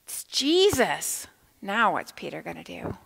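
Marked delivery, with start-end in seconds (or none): 0.0-1.3 excited reveal. Acted.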